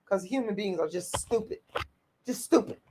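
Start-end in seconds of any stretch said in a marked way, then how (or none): none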